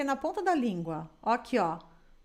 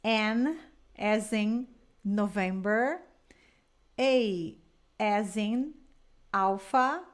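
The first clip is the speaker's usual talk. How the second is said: slow, carefully spelled out letter by letter; slow spelling demo; 2 semitones lower, much slower than usual. Shown as a demonstration. Teacher spelling a word slowly for her student. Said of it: "se eu fosse soletrar nap".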